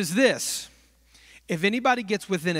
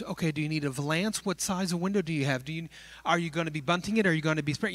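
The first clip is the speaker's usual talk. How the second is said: fast clerk patter, questions tumbling out; rattling off questions; 3 semitones lower, 4 dB quieter, faster than usual. Retold or imitated. Clerk to the curtain shopper, fast patter. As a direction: reel off the sales questions quickly.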